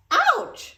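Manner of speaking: sharp high yelp of pain; sudden high ouch. A narrator's reading. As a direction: cry out as if something jabbed her back. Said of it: "she cried".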